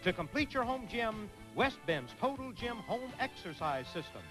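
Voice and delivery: smooth prize announcer voice; announcer read. Acted. Game show prize announcer read.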